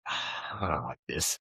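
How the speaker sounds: resigned salesman, quiet and slow; deflated mumble. Retold or imitated. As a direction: mutter it like you've given up. Salesman admits defeat, quoted.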